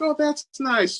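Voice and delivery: high-pitched, mildly indulgent imitation; polite in-laws. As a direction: humor her with mock politeness.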